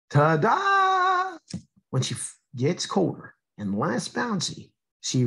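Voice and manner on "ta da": much higher cheerful reveal; little raindrop proudly reappearing; cartoon ta-da. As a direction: sing out the reveal like a tiny showgirl. Drop character reappears.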